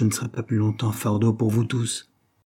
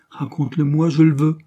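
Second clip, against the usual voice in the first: insistent; 5 semitones higher, 5 dB louder than usual. A narrator's reading. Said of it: "persista Henry".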